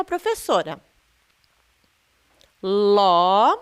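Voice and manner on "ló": "Ló" com a vogal bem aberta, sustentada; som aberto. Shown as a demonstration. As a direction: hold "Ló" long with an open O. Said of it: "o som desta sílaba tônica é um som aberto". ("Ló" com som aberto e alongado)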